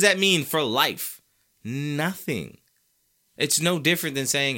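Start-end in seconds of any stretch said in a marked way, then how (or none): none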